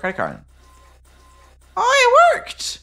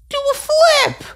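high, loud squeal of delight; excited squeal; 6 dB louder than usual. Real, not acted. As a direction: shriek it as you flip. Shouting as he flips.

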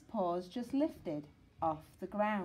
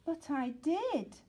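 hurt pup; 8 semitones higher than usual. Acted. Sid insists to teacher.

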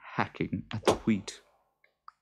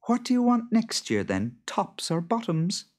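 high, quick sly farmer's wife; Beth's voice; 14 semitones higher, 4 dB louder than usual. Acted. Beth slyly asking the Boggart, high and brisk. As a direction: tease him with the question.

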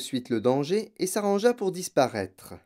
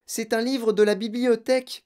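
crying out; 9 semitones higher than usual. Acted. Mathilda protesting as her book is torn, crying out. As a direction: call out to stop him. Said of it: "cria Mathilda".

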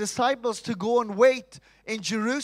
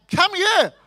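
eager come here; 10 semitones higher, 6 dB louder than usual. Retold or imitated. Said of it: "it's like".

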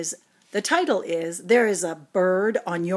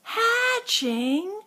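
very high squeaky disbelief; high startled question; shocked question; 12 semitones higher than usual. Acted. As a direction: squeak it out in alarm. Eggs hatching on his head.

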